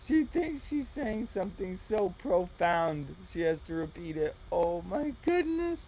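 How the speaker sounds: high, laughing disbelief; laughing mockery. Real, not acted. Reactor laughs at the clip woman's speech, laughing. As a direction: laugh at how profound she thinks she is.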